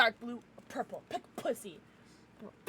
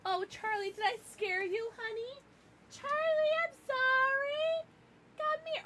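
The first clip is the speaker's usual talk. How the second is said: high sing-song pet voice, much higher. Real, not acted. Streamer soothing her scared dog, high baby talk.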